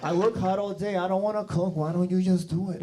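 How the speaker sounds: mock Puerto Rican whine; Puerto Rican accent. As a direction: mimic the wife's Puerto Rican lilt, pleading. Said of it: "with the Puerto Rican".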